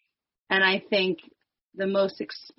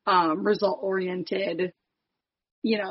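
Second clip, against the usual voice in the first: ordinary delivery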